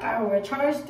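usual speaking voice

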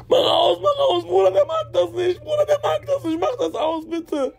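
very high-pitched, frantic wailing yell; retold hysterical screaming. Panicked friend screaming at the narrator to stop the Koran, hysterical. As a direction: imitate a friend screeching in panic, begging. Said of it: "der hat mich so angeschrien".

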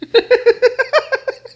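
high, loud burst of laughter. Real, not acted. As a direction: crack up helplessly. Laughing at the clip.

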